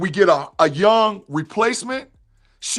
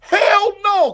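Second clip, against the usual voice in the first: loud shouted hell no; 9 semitones higher, 5 dB louder than usual. Real, not acted. Answering Claude in chat with a loud shout. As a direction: bark it back at the chat, fully loud. Rejecting chat's claim, shouts.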